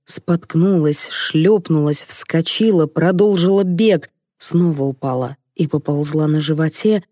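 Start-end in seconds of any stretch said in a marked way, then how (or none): none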